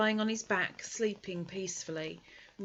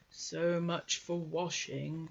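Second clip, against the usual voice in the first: grumbling Eeyore; 3 semitones lower, slower than usual. Acted. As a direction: drag every word out like a disgruntled old donkey. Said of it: "said Eeyore".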